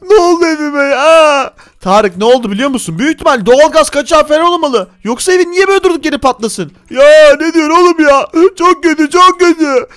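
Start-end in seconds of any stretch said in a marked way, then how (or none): 0.0-1.5 high, slow tearful wail; sob, then wail the question slowly; crying. Acted. Player asking what happened to his house, crying. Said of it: "ağlama". 6.9-9.9 high, raised-voice wailing; tearful complaint. Acted. Player lamenting to the friend consoling him, tearful complaint. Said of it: "ağlama, düzelttim bak".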